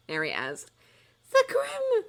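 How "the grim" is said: high, loud dramatic cry; the seer crying out at the boy's ignorance. A narrator's reading. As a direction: wail it like doom is here. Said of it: "cried Professor Trelawney".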